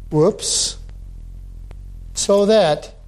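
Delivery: normal talking voice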